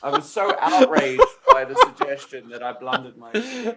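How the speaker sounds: high, loud, laughing hard through the words; helpless laughter. Real, not acted. Player admitting a blunder to training partner, laughing hard. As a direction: talk through real uncontrollable laughter.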